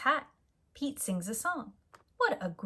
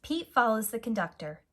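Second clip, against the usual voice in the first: usual speaking voice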